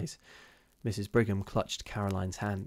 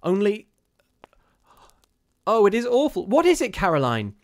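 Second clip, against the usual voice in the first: high, loud cry of horror; horror-stricken; 11 semitones higher, 9 dB louder than usual. A narrator's reading. Missus Brigham to Caroline about the shadow, horror-stricken. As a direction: plead for an answer in horror. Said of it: "whose horror-stricken tone matched her sister's".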